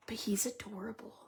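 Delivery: voiceless whisper, kept secret. Real, not acted. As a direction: whisper so he won't hear. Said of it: "I have to whisper".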